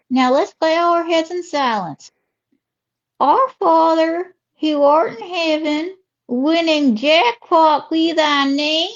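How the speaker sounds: mock-solemn prayer in a slow Southern drawl; Southern lady praying. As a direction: intone it slowly, like a Southern church lady. Ruby says her slot machine prayer.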